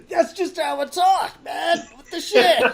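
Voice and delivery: loud, high put-on protest; mock-offended actor. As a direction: protest loudly in a silly high voice. Playing actor offended about accent.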